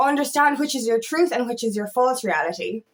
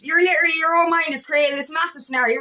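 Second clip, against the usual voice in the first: ordinary delivery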